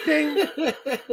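a high sung ding like a bus bell. Retold or imitated. Mimicking the bus stop bell, high. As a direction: chime it out high and bright.

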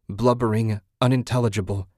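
ordinary delivery

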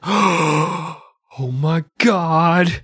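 mock gasp.

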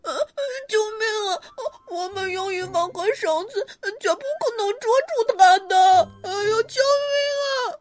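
terrified bear cub, high and shaky; panicked cry for help. A narrator's reading. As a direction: shake with fear calling for help. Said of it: "小熊打着哆嗦说".

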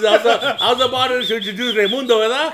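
loud, high, laughing hype; laughing loud intro; hyped announcement. Real, not acted. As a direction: build him up like a ring announcer. Hyped intro of Raimundo persona.

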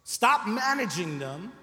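normal talking voice

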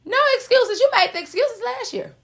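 rising fervor; high emphatic. Shown as a demonstration. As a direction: call it out emphatically.

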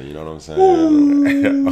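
high, loud, drawn-out hype call; squealed-up mock announcer bit; hype man voice. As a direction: ham it up like a radio hype man. Hyped podcast intro catchphrase.

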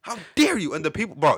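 hammed-up outrage, loud; swearing tirade. Rant continues.

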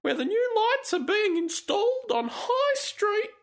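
sanctimonious falsetto parody; mocking high reading voice. Reading the post in put-on voice.

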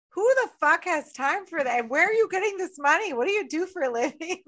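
high-pitched, exasperated mock disbelief; incredulous questioning. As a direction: fire questions at him in disbelief. Acted incredulous questioning of the guy.

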